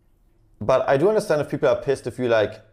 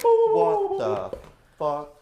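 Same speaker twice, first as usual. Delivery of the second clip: high drawn-out squeal; much slower than usual. Pretend. Goofy sign-off at stream end.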